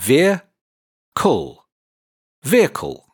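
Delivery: "Veer, cull" then blended into "Vehicle"; sounds combined. Shown as a demonstration. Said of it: "Now let's put the two sounds together".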